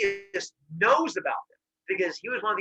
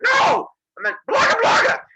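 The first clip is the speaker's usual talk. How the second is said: very high, loud squawked protest; protesting monster; 11 dB louder than usual. Retold or imitated. Monsters told they could be left in the pit, frantic high protest. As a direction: squeal the objection, then the gibberish.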